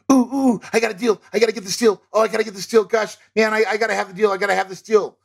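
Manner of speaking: high, breathless over-eager investor gushing about a deal; overexcited deal chaser. Retold or imitated. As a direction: squeal like someone who can't see past the shiny deal. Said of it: "here is a great example of".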